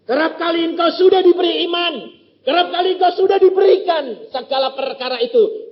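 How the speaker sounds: full-force preaching. Acted. Preacher exhorting the congregation, loud and high. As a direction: proclaim it to the whole hall.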